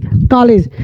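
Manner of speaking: "कॉलेज" said as "kaalej"; long open aa in "कॉलेज"; open AA pronunciation. Shown as a demonstration. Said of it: "कॉलेज पढ़ते हैं".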